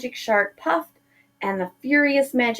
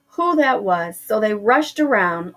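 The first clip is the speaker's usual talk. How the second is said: ordinary delivery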